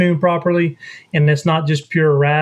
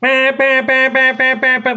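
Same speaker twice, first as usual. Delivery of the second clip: high raspy quacking bah bah; raspy duck hail imitation; 9 semitones higher than usual. Shown as a demonstration. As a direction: quack it out like a hen mallard. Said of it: "It's gonna be like".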